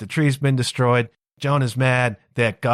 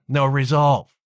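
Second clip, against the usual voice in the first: ordinary talk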